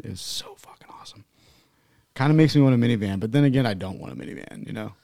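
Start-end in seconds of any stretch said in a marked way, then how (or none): 0.0-1.2 whisper. Real, not acted. Hushed awe over minivan.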